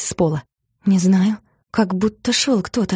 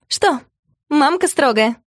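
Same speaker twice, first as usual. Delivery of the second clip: giggling; 6 semitones higher, slower than usual. Acted. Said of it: "хихикнула Мотя".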